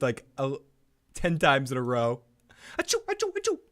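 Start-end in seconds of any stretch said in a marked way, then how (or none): 2.8-3.6 high mock sneezing; fake sneezes. Shown as a demonstration. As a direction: sneeze it out theatrically. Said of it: "I just sneezed".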